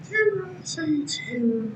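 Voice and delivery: high mock-theatrical cry; mock dramatic. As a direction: play the melodramatic ham. Hammed-up horror voice.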